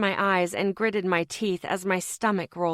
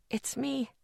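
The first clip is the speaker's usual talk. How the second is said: higher, much softer near-whisper; a soft, higher tearful hush; 5 semitones higher, 8 dB quieter than usual. Acted. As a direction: hush it gently to reassure him. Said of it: "I whispered".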